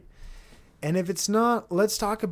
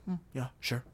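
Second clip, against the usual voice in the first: bad, much softer Elon mumble; a half-hearted mumbled Elon; Elon impression; 2 semitones lower, 9 dB quieter than usual. Elon shrugging off the plan, a softer, half-hearted mumble. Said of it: "I can't do an Elon impression".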